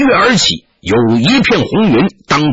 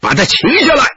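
higher shouted order to seize him; loud command; 10 semitones higher than usual. A narrator's reading. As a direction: yell it like a chieftain commanding troops. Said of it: "大声喝道".

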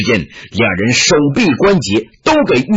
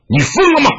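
sharp, high-pitched reprimand; stern rebuking shout; 12 semitones higher, faster than usual. A narrator's reading. Elder Taoist confronting his raving disciple, high rebuking shout. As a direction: shout him down, startled and commanding. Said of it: "黄叶喝道".

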